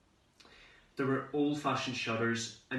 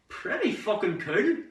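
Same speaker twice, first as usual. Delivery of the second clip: high, louder, thrilled description; delighted; 6 semitones higher, 4 dB louder than usual. Acted. Teenager discovering his own room abroad, excited. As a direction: show off the room with rising excitement.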